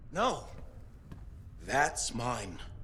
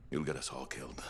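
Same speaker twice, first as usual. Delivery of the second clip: low, softer breathy warning; grim whisper; 8 semitones lower, 7 dB quieter than usual. Acted. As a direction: hiss the threat under your breath. Heated argument, grim warning.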